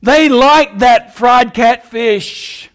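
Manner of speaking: slow, drawn-out relish with a wink; tongue in cheek. Real, not acted. Preacher joking about the crowd's appetite, tongue in cheek. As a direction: stretch out each word, playfully teasing. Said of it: "I say that tongue in cheek".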